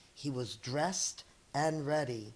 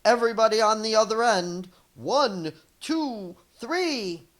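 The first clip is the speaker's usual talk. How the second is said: louder, fast orders then a counted countdown; commanding shout; 4 semitones higher, 9 dB louder than usual. Acted. Dex shouting orders to the animals, loud. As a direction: direct the crowd like a captain.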